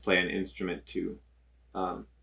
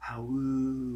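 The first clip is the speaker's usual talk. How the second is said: soft, hushed wolf howl; quiet wolf howl; 4 semitones higher, 6 dB quieter than usual. Pretend. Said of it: "but be quiet".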